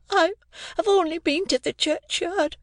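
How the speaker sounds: put-on timid child answering; young Pip. A narrator's reading.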